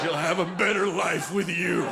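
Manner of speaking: hammed-up tearful soldier farewell; overdramatic war movie farewell. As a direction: play it like a soldier handing off a child. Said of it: "I was so dramatic".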